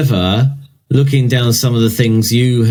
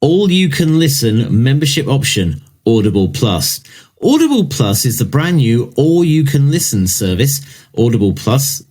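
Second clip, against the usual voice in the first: marketing read; 4 semitones higher than usual. Acted. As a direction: press release in marketing style. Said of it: "I'll do it in a marketing style".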